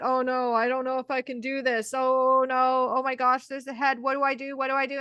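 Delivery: imitating a panicking partner, lightly voiced; half-hearted flustered fretting; ex-partner freaking out at the birth, mild mock panic. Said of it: "my ex-partner was freaking out".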